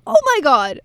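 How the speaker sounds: can't contain the thrill; excited. Real, not acted. Podcast host gushing over a Disney film, excitedly. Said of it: "Sorry, I'm getting a bit excited".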